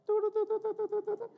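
loud, very high "do-do-do" signal; rapid-fire high telepathy beeps; imitated beeping. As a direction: shoot off piercing little signal beeps to your wife. Imitates silent look exchanged with wife.

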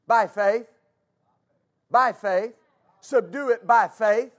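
usual speaking voice

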